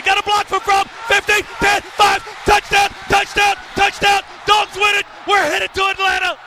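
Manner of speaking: high, loud touchdown call; frantic sports commentary. Real, not acted. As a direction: yell the winning score to the crowd. Touchdown call in live play-by-play.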